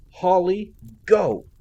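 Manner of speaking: loud, high shouted order; urgent shout. A narrator's reading. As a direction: yell it to make her run. Wounded commander orders her away.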